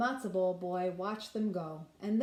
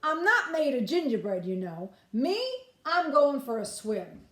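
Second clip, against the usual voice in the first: put-on cocky boy; 5 semitones higher, 6 dB louder than usual. Boy boasts to the fox.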